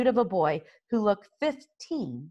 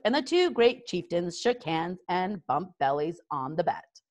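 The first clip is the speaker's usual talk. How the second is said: usual speaking voice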